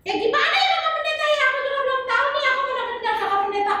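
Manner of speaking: put-on high, sing-song little girl voice; chirpy kid puppet answering the pastor; high child puppet voice. Tiur unsure of story.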